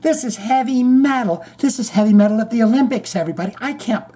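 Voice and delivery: high, gushing mock commentator; mock breathless announcer. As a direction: squeal it like an overexcited TV host. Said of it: "the lady was talking over it".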